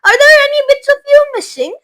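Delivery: high, loud panicked questions; calling out in fear for her boy; frantic worry. A narrator's reading. Mother frantically checks on her shrunken son.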